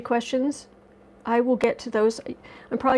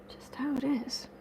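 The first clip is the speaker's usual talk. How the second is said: whisper; 3 semitones higher, 11 dB quieter than usual. Real, not acted. Trailing whispered aside.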